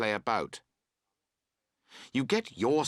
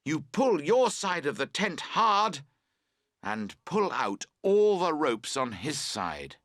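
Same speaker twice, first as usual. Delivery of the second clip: plain reading voice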